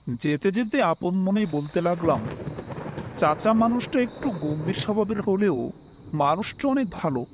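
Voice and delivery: usual speaking voice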